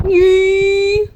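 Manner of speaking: high loud goofy yee; silly squeal. Pretend. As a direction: squeal it out for the camera. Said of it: "just go".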